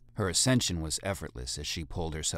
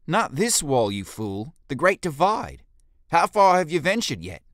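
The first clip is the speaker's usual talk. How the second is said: much higher, much louder furious shouting; furious leader; 11 semitones higher, 10 dB louder than usual. Acted. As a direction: tear into the fool at full volume. Jolie berates the guard.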